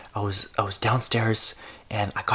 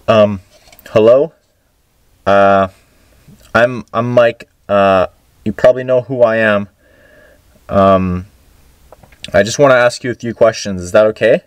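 much louder, halting address with pauses; calling out tentatively; 11 dB louder than usual. Pretend. Introducing himself to the spirit in the box, loud and hesitant. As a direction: speak up clearly to an unseen presence, hesitant.

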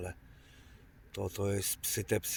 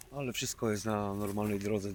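ordinary talk, nothing marked

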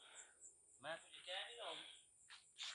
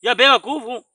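loud, raised-pitch irritation; exasperated; 4 semitones higher, 9 dB louder than usual. Real, not acted. Companion pushing Majid to go and look, exasperated. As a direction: scold a hesitant friend loudly.

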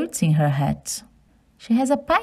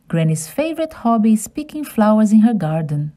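usual speaking voice

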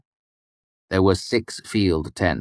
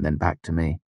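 plain reading voice

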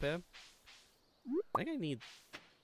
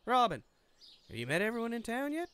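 cheerful woman voice; 8 semitones higher, 3 dB louder than usual. Acted. Voicing Robin.

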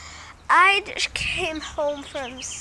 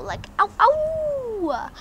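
much higher, slow howl; imitating dog howl; 10 semitones higher, 5 dB louder, much slower than usual. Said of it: "I have saw Jackie howl".

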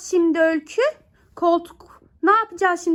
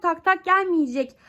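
usual speaking voice

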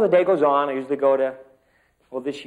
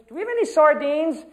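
put-on child calling her mom; 7 dB louder than usual. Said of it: "She says".

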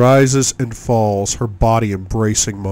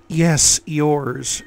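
indulgent woman; 6 semitones higher than usual. A narrator's reading. Woman's indulgent reply, voiced.

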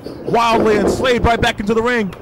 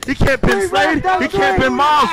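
excited call; 6 semitones higher than usual. Real, not acted. Commentator rising excitement.

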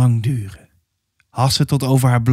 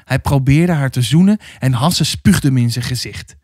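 plain reading voice

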